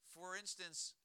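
usual speaking voice

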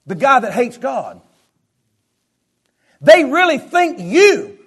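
slow, high shout building to a roar; full-force preaching. Real, not acted.

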